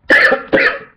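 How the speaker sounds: harsh loud coughing; coughing fit. Real, not acted. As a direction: cough hard and rough. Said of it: "excusez-moi".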